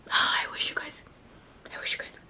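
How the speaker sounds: breathless whisper over a lovely scent; whisper, as if the smell takes her breath; hushed awe at the smell; real whisper. Real, not acted. Sniffing scented body butter.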